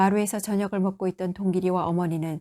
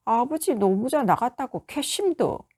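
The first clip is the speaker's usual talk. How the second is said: put-on sulky boy; 5 semitones higher than usual. A narrator's reading. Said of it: "약간 뽀르퉁해졌다".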